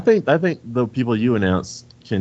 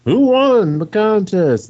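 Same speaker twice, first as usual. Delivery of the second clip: high, loud, drawn-out mock demand; stretched-out mock impatient yell; impatient listeners; 11 semitones higher, 6 dB louder, much slower than usual. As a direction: imitate listeners pestering for the result, slow and high. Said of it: "some people have been like".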